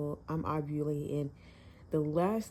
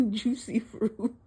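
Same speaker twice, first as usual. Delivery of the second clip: laughing; 6 semitones higher, 4 dB louder, slower than usual. Real, not acted. Laughing while retelling the karaoke.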